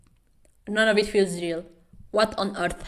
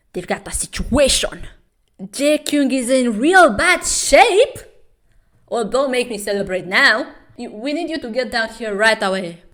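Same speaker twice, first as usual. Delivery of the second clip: lightly voiced frantic coach on the phone; a hint of a panicked coach; voicing the coach; 5 semitones higher, 9 dB louder than usual. Coach calling the doctor, lightly urgent voice.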